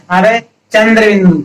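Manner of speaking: ordinary talk, nothing marked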